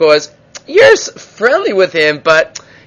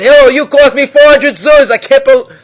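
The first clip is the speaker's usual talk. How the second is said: higher, louder mock scream; 8 semitones higher, 4 dB louder than usual. Frustrated bettor screaming at Hillel over the lost bet, high and loud. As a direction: blurt it out at Hillel, raised and high. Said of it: "finally he screams at Hillel".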